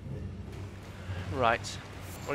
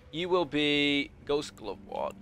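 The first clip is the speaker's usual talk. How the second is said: slow grand declaration; 3 dB quieter, slower than usual. Pretend. Claiming an item dramatically.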